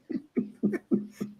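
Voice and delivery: high, airy chuckle; light giggly laugh; real laugh. Real, not acted. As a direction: chuckle to yourself at the joke. Amused by flower joke.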